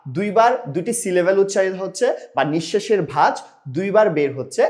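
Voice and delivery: ordinary delivery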